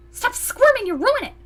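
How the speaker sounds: high, loud fussing; playful scolding. Acted. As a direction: scold a wriggling friend. Roommate scolds while guiding her.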